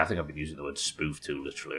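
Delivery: usual speaking voice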